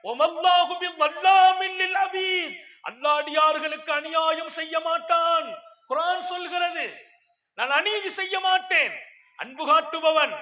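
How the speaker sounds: high, loud, shouted sermon; preaching at full force. Acted. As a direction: thunder the verses out to the hall. Preacher opens with Quran quotes.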